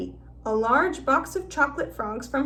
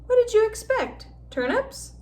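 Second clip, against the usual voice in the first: teasing; 10 semitones higher than usual. A narrator's reading. Ron teasing Harry.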